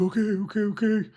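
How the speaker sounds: put-on scared kid. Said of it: "He's all scared".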